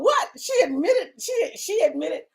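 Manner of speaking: high, laughing mock gasp of shock; shocked viewers. Imitating shocked viewers gasping with laughter. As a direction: play the stunned audience, laughing. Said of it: "some people are shocked, going".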